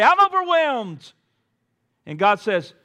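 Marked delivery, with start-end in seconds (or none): 0.0-1.1 high, slow, louder cry of being overwhelmed; as if throwing up your hands. Acted. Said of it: "we say".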